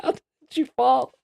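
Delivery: incredulous and cracking up; high-pitched giggling accusation; laughing disbelief. Retold or imitated. Laughing at friend's excuse.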